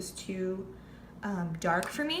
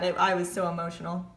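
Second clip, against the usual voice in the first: ordinary delivery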